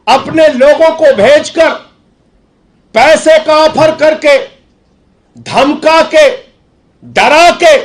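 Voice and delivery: very high, loud declamation; political oratory. Real, not acted. Accusing Modi of breaking Delhi's MLAs, forceful oratory. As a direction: hammer out each accusation high and hard.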